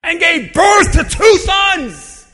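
loud, slow preacher shout; preaching. Acted. Preacher shouting to the congregation. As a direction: preach it out at full force.

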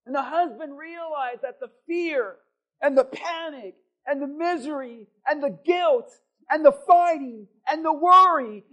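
loud, slow, rising preacher cadence; preaching at full force. Real, not acted. Pastor proclaims to congregation, full-force preaching. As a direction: preach it to the rafters.

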